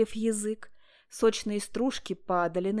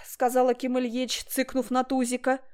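ordinary delivery

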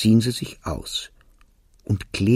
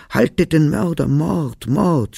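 high, raised cries of murder; crowd's shouted alarm; 6 semitones higher, 4 dB louder, slower than usual. A narrator's reading. Neighbours crying out after the fleeing killer, shouting. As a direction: call out the alarm, as read in a story. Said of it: "schrien sie überall".

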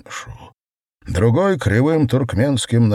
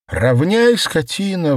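sharp shout, pitched much higher and louder; sudden shout; 10 semitones higher, 4 dB louder than usual. A narrator's reading. Wife suddenly shouts at the servant, high and loud. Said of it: "вдруг закричала она".

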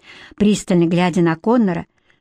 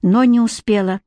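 plain reading voice

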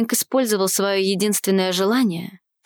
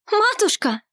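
high, joyful cry; exclaiming; 11 semitones higher than usual. A narrator's reading. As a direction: call out in sudden relief. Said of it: "воскликнула Елеоль".

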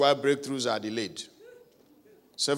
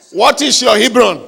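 louder, shouted with conviction; mean it; 6 semitones higher, 12 dB louder than usual. Shown as a demonstration. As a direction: belt it out like you mean it. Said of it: "Say it like you mean it".

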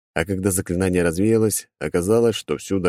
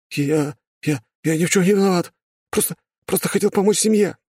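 high panicky stammer; scared stammering boy; 13 semitones higher than usual. Acted. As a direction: stumble over words in fear.

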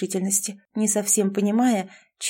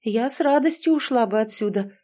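ordinary delivery, nothing marked

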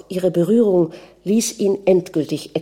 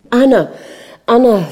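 loud, raised cry of her name; alarmed cry; 5 semitones higher, 7 dB louder than usual. A narrator's reading. Prince calls to his unconscious wife, crying out. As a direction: call out to her in shock. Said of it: "schrie der Fürst".

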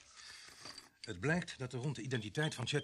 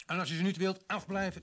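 higher, louder telling-off; sharp scolding; 7 semitones higher, 6 dB louder than usual. Acted. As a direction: snap at him to keep his hands off. Sergeant guards his sandwiches.

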